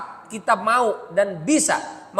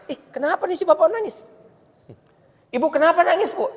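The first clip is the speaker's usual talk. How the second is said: high-pitched, mock-puzzled questioning; puzzled onlooker; 8 semitones higher than usual. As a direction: imitate a nosy bystander asking why. Acting out puzzled mosque onlookers.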